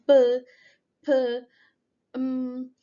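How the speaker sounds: B, P, M as isolated lip sounds; bilabial sounds. Shown as a demonstration. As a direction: sound out B, P, M with both lips together. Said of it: "first is bilabial sounds".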